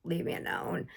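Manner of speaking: breathy whispered plea from a fed-up cat; the old cat pleads to be left alone, whispered; weary cat whisper. Pretend. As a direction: whisper it like a worn-out cat begging for peace. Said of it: "and he's just like".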